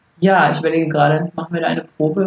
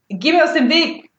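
annoyed; 8 semitones higher than usual. Acted. Annoyed agent brushes off Jawa.

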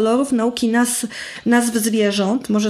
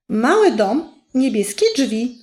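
put-on reading to children. Shown as a demonstration. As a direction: read it to a toddler, softening on the knocks. Starts reading picture book aloud.

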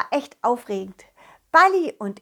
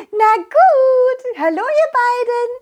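very high, tiny fairy voice; high, loud fairy hello with a giggle in it; giggly little fairy; 13 semitones higher, 6 dB louder, slower than usual. Acted. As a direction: greet them in a squeaky fairy voice. Fairies reveal themselves and greet.

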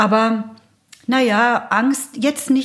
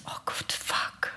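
horrified whisper under her breath, slow; 14 dB quieter, slower than usual. Retold or imitated. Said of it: "Und ich so". (entsetztes Flüstern)

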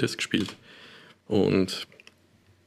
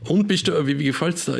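parent's voice; 8 semitones higher than usual. Retold or imitated. Parents asking about the game.

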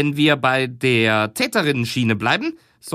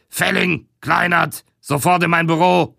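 raging boss; 6 semitones higher than usual. Acted. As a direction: bark the names up high. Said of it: "Der Chef tobt".

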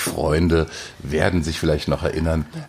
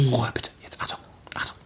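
whispered warning, nearly silent; hushed whisper; 6 semitones higher, 6 dB quieter, faster than usual. Real, not acted. Host whispering before dropping two grapes in the glass. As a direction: shush the room and whisper the cue.

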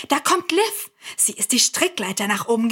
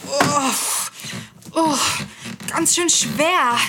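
straining; 3 semitones higher, slower than usual. Acted. Straining under heavy suitcase.

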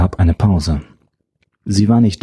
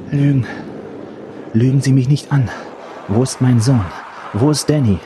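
higher, pleading mother demanding the truth; desperate mother; 5 semitones higher than usual. Acted. Mother pleading with the professor for her son, higher and desperate. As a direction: beg him to stop lying, voice raised high.

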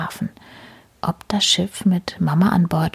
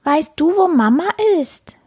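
eager high little voice, a bit louder; small kitten begging an answer; 10 semitones higher, 5 dB louder than usual. Acted. Kitten asks the rat. (hohe Kätzchenstimme)

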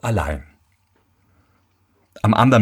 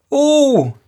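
lügender Sohn; 4 dB louder than usual. Acted. Lügender Sohn antwortet dem Vater.

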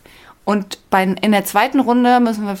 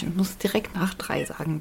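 laughing whisper; 3 semitones lower, 9 dB quieter than usual. Real, not acted.

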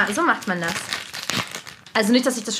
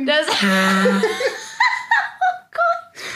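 laughing; 6 semitones higher, slower than usual. Real, not acted. Reaction to funny trumpet sound.

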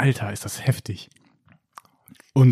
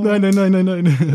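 loud, higher repeated "nein" in dismay; mock despair; 6 semitones higher, 5 dB louder, slower than usual. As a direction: moan no over and over, hammed up. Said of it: "Und ich war so". (laute, höhere, wiederholte „nein“ in gespielter Verzweiflung)

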